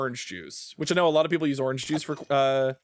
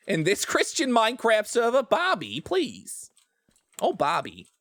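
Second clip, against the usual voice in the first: put-on lightly done Long Island mom accent, pleading Bobby; a hint of Linda's Long Island whine; Linda Belcher accent; 6 semitones higher than usual. Still in the bit for friends, a light Long Island mom accent. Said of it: "Linda Belcher".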